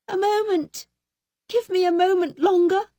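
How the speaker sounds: high begging voice; pleading wife. A narrator's reading. Wife begging Bluebeard for time. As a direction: stall him desperately.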